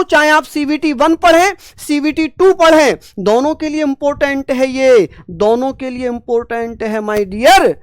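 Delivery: getting swept up and raising the voice while teaching; absorbed loud teaching. Real, not acted. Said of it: "मेरी voice तेज हो रही है".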